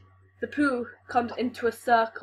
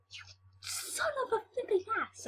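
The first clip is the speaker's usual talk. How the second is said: squeaky insult; 12 semitones higher, 9 dB quieter than usual. Retold or imitated.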